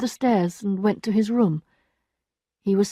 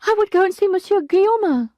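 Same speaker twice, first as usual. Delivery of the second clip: high, loud servant's voice; the maid breaking bad news, high and loud; distressed maid; 12 semitones higher, 5 dB louder than usual. A narrator's reading. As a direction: cry it out as the upset maid. Maid's voice.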